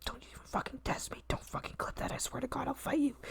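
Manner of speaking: whispered threats under the breath; a mock-sinister stage whisper; menacing whisper. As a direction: hiss it like a secret threat. Muttered threats after fake apology.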